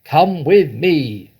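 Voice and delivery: slow, loud command; a father hauling his son off by the arm; yelling. A narrator's reading. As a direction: bark it as you grab him. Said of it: "Mister Cranky yelled".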